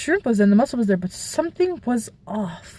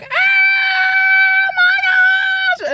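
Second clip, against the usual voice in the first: very high, loud shriek; shrieked so loud the whole restaurant looked; 4 dB louder, much slower than usual. Retold or imitated. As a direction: scream out in horror in the restaurant. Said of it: "I shrieked so loud".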